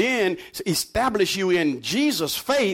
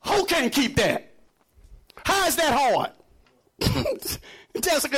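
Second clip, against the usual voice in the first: ordinary talk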